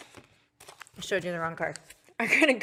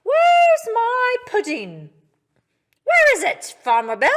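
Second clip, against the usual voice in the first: impatient animals; 10 semitones higher, 10 dB louder than usual. Pretend. Animals calling to Farmer Bill for pudding. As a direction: whine for the pudding.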